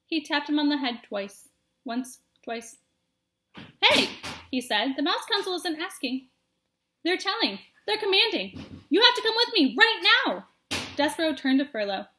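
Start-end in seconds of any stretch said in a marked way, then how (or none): 3.8-10.5 much higher and louder demand; insistent command. Acted. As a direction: order him to come now. Furlow commanding.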